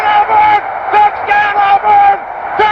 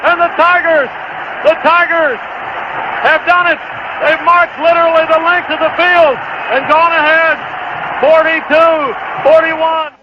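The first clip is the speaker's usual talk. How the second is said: touchdown call. Real, not acted. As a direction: scream the winning score. Game-winning touchdown call.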